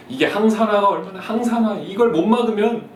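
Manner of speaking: high, loud alarmist TV host; hammed-up TV health warning; health show alarm. Mom hearing a TV show, mock alarmist voice. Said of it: "이렇게 들리는 거예요".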